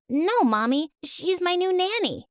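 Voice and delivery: put-on high child voice, rising to a squeak; a kid insisting to her mom; pleading little girl. Little girl defends new nanny.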